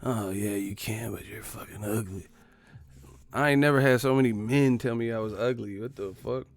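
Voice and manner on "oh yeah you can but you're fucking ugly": soft mock-lustful murmur; creepy fan. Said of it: "men have, like, weird fetishes with me".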